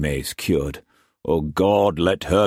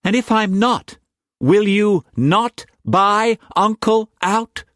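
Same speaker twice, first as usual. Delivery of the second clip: put-on young woman challenging; 4 dB louder than usual. A narrator's reading. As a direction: test him, high and deliberate.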